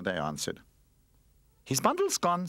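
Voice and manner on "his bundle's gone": high-pitched shocked announcement. A narrator's reading. Toy finds the runaway's things missing, shocked. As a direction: raise the pitch in alarm. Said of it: "said a shocked Charlie Chip".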